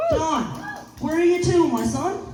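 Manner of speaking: loud, high Newfoundland call; hearty Newfoundland "where are you to"; Newfoundland greeting. Pretend. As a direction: hail him like a Newfoundlander. Host quizzes guest in local dialect.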